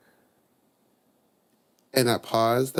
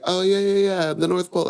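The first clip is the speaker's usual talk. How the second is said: much higher, hurried mom stalling; flustered mom; 8 semitones higher, 4 dB louder, faster than usual. Retold or imitated. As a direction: bluff your way through like a caught-out mother. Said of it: "She was like".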